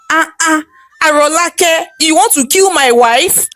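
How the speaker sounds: high, outraged cry; husband catching maid poisoning his wife; shocked yell. Acted. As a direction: catch her in the act and cry out. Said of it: "Bode screamed".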